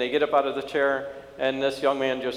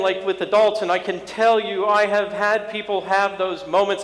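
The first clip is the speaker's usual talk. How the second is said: high, insistent sermon voice; impassioned preaching; 7 semitones higher, 5 dB louder than usual. Acted. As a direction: press the point home to the congregation. Preaching the point.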